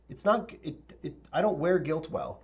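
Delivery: ordinary talk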